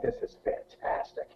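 breathy, voiceless whisper of glee; hushed gleeful whisper; whispered aside. Retold or imitated. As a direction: whisper it to yourself, delighted. Gleeful aside watching girls.

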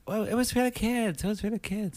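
high, soft, put-on pleading voice; whiny excuse voice. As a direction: whine the excuse like a guilty wife. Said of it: "And she's like".

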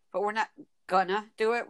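usual speaking voice

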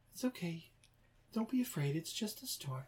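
hushed, high-pitched comforting voice; soothing. Acted. Owner calming Buddy during the storm, soft soothing. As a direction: coo it softly, stretching the last words.

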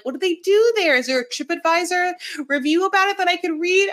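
high, eager questioning; overly keen planner; eager researcher. Retold or imitated. Mimicking the over-researcher.

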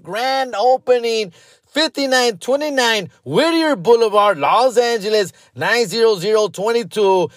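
loud mock radio announcer pitching chocolate strawberries; hyped radio ad read. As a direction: sell it like a cheesy local radio spot. Fake ad read for show sponsor.

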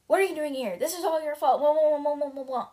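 angry outburst. Acted. Roderick yelling at Bert that it's all his fault. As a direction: throw the blame at him.

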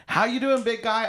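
high-pitched, over-familiar greeting; mock hearty greeter. Mimicking people who call him big guy, high and hearty. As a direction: imitate an overly chummy stranger.

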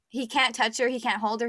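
normal talking voice

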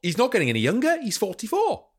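high, lilting Welsh voice; Welsh accent. Retold or imitated. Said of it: "the Welsh accent".

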